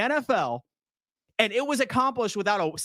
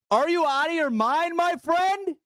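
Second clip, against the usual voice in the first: rising shout; 8 semitones higher than usual. Real, not acted. Ranting at a timid fan, shouting in disbelief. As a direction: voice climbs high into a shout on the repeat.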